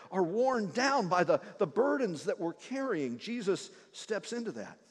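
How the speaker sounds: ordinary talk, nothing marked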